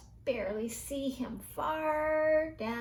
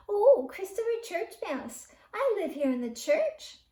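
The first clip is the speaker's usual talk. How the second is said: put-on young mouse voice; 6 semitones higher, 5 dB louder than usual.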